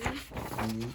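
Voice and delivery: plain reading voice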